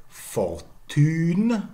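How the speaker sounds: "Fortune" as for-TÜN, French u; French pronunciation. Shown as a demonstration. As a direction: say "Fortune" in French, stressed at the end.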